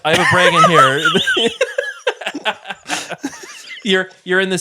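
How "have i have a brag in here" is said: slow, high, louder hammed-up pirate; mock pirate. Lost pirate on a mountain, slow and hammed-up. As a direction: drag it out like a goofy lost pirate.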